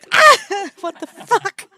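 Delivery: loud, high shocked shout; startled yell. Real, not acted. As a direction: yell in sudden alarm. Sudden burst, much louder, higher.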